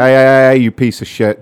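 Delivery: shouted hey, hey at a dead line; mock yell. Prankster yelling at the caller who hung up, mock yell. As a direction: bark insults into the phone for laughs.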